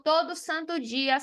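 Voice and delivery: ordinary talk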